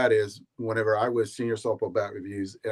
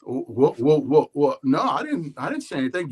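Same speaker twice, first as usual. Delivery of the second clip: stammering, higher-pitched protest; mock flustered denial; 3 semitones higher than usual. Accused bat critic denying it to the bat maker, flustered. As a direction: imitate a caught man tripping over his denial.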